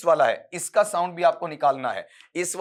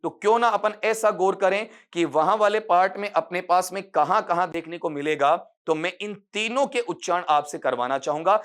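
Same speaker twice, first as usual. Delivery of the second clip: ordinary delivery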